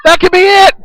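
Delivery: shouting the finish, high and loud; live ringside call at full volume; excited play-by-play. Acted.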